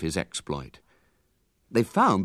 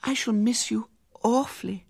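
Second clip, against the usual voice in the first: put-on pining girl; 14 semitones higher than usual.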